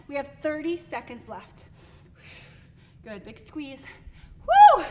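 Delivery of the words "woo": high, loud cheer; excited whoop. Real, not acted. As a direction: whoop through the burn. Cheering during workout.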